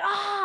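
high mock battle scream; hammed-up high scream. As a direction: fake a stage death cry. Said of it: "screaming and chopping".

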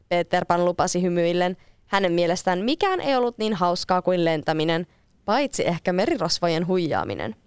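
plain reading voice

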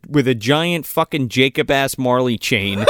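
ordinary delivery

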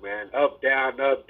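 normal talking voice

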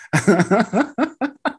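high bright laugh; burst of laughter. Real, not acted. Laughing at own joke.

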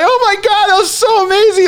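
very high, teary gush of amazement; overjoyed gush. Retold or imitated. Friends crying together after the trip, gushing in high tearful joy. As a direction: gush it out high, close to tears.

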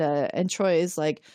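ordinary talk, nothing marked

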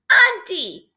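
high, loud excited call; ringing, high-pitched excitement; excited exclamation. A narrator's reading. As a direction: call out eagerly to a relative. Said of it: "Shiverine exclaimed, excited".

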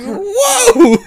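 high, drawn-out mock cry of alarm; comic shocked whoa; startled yelp. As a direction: yelp as if suddenly whacked, half laughing. Retold yelp on being beaten.

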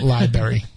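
"library" as lie-berry; mispronounced library. Shown as a demonstration. Co-host rejecting the wrong version, saying lie-berry.

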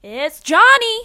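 loud, high horror-film holler; shouted movie catchphrase. Acted. Co-host naming The Shining's killer, loud theatrical shout. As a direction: yell it out like a villain's entrance. Mimicking The Shining's famous call.

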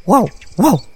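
put-on a little woof from baby chicks; comic woof-woof; chicks barking. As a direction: bark like a tiny chick. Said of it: "tres pequeños pollos".

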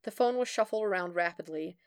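plain reading voice

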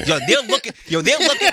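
flustered, rising stammer; stuttering stressed guy. Retold or imitated. Mimicking Lil Rel stuttering.

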